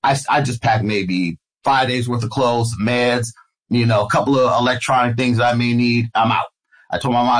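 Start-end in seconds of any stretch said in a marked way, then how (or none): none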